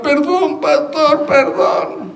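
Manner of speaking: high-pitched pleading, hammed up; mock pleading apology. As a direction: imitate someone begging the pastor's pardon. Retold apology from caught gossiper.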